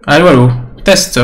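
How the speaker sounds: son saturé, micro qui crache; voix distordue par la saturation. Real, not acted. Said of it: "Le son sature". (micro saturé, son distordu)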